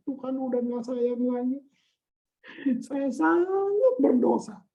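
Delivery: drawn-out, tearful put-on self-blame; mock remorseful wail. Preacher acting a sinner who feels unloved, mock tearful. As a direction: wail it slowly like a guilty sinner. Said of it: "terus kamu bilang ini".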